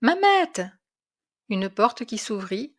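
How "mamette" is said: high, loud call to his wife; much higher, excited call for his wife. A narrator's reading. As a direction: call her name down the hall. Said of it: "en appelant".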